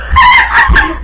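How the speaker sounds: shrieking high laugh; screeching laugh; loud laugh. Real, not acted. Laughing at the chaos.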